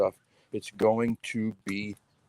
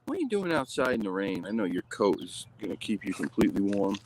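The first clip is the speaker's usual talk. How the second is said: ordinary talk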